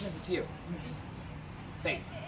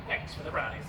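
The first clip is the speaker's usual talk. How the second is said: low character voice; 9 dB quieter than usual. Acted. Narrator voicing Macbeth in story.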